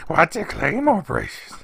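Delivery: hammed-up high creature voice, the bolder second try; terrible Schmeagol impression. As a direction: squeak it out like a creepy little creature. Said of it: "That's my terrible Schmeagol impression".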